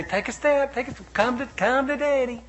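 put-on high, coaxing voice to a toddler; coaxing daddy. Father coaxing his toddler to walk, in a high coaxing voice. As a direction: coax a baby to take a step, high. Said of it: "I'd say".

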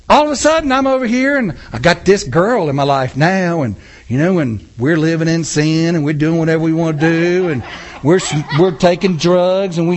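ordinary delivery

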